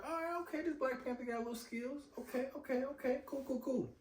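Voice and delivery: high, soft casual "okay, cool"; half-impressed shrug of a voice; lightly voiced shrugging fan; mock unimpressed viewer. Fan voicing his own cool reaction.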